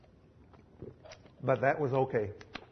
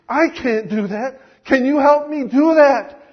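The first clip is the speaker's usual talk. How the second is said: rising, loud cry for help; desperate plea; 7 semitones higher, 8 dB louder than usual. Acted. Zoltan begs Jesus for help, desperate and loud. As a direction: break into a pleading cry.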